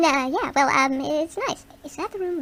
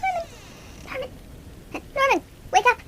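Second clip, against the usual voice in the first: high, breathless overdramatic fear; hammed-up panic; 6 semitones higher, faster than usual. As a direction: overplay the terror to wake him. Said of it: "Is that too dramatic?".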